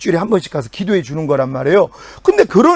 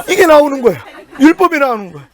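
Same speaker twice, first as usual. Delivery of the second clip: laughing; 6 semitones higher, 4 dB louder, faster than usual. Retold or imitated. Retelling wife's bitter thoughts, laughing.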